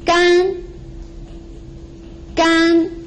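gan held flat and high; first tone. Shown as a demonstration. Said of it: "It's flat and it's a little bit high".

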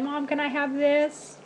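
put-on high, slow little-girl plea; mock kid voice asking for food; imitating her daughter. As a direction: ask sweetly like a child begging mom. Mom voicing her daughter.

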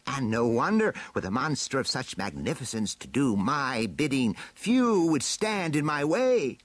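high, gloating villain climbing to a peak; boastful villain. Acted. Villain boasts of his new monster, high swelling gloat. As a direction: boast grandly, rising and slowing to the end.

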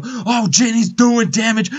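usual speaking voice

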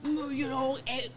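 high, scoffing, unimpressed grunt; dismissive guy voice. Retold or imitated. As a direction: brush it off like a smug guy. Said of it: "cis heterosexual men are like".

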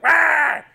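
loud, high "Rah!" war cry; imitating a villain's roaring war cry; battle cry. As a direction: bellow it like a villain charging in. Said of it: "and he's like".

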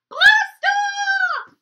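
very high, loud blast-off yell; shout. Shown as a demonstration. Teacher leads kids to jump, huge excited yell. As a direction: shout it like a launch. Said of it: "jump as high as you can when we say blast off".

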